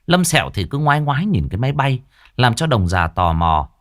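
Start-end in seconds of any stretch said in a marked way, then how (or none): none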